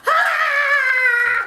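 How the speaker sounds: very high, loud held "aaah"; mimicked yell. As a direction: imitate a man's long triumphant yell.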